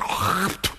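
imitated hawk and spit; mock spitting sound; spitting noise. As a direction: mime a throaty hawk, then spit. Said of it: "And then he goes".

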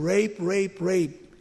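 normal talking voice

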